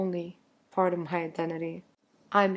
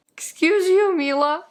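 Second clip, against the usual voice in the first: laughing, louder and higher, at the cat; laughing scold; 10 semitones higher, 7 dB louder than usual. Real, not acted.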